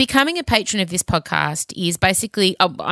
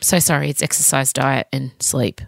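ordinary talk

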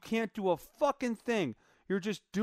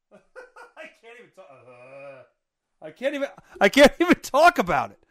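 laughing too hard to talk; disgusted laughter; 2 semitones higher, 10 dB louder than usual. Real, not acted. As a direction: laugh through the revulsion until the words burst out loud.

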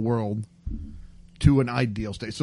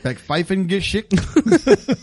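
mock German; 5 semitones higher, 4 dB louder, slower than usual.

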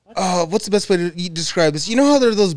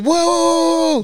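high startled whoa; scared whoa; 11 semitones higher, 3 dB louder than usual. Retold or imitated. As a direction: panicky whoa, pitched up. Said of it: "He was like".

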